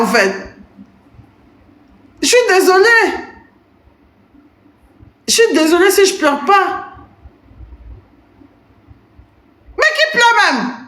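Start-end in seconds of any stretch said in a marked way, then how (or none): 2.2-10.8 much higher, singsong fake sorry; high teasing pretend apology; mock apology. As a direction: play at being sorry. Mock apology about crying.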